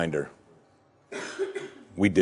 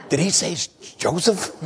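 disbelief; 7 semitones higher than usual. Acted. Brothers asking each other in disbelief.

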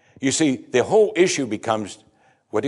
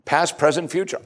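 emphatic preaching; 6 semitones higher, 3 dB louder than usual. Acted. Preacher building to emphatic peak.

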